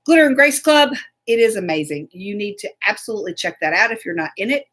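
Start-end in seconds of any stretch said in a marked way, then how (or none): none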